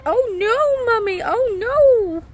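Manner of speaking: high, slow, lightly voiced little girl; imitating toddler. Mother repeating her daughter's words, light toddler imitation. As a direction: mimic a small child's oh no. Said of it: "She's saying".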